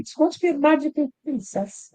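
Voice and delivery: high sing-song princess voice; magical princess voice. Pretend. Teasing a co-host with a high magical princess voice. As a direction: lift it way up like a fairy-tale princess. Suggesting a voice to try.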